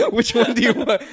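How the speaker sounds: amused high question; laughing. Real, not acted. Still laughing.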